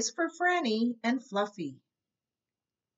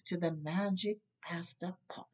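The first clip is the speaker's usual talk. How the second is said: whisper; 3 semitones lower, 7 dB quieter than usual. A narrator's reading. Narrator whispers the secret.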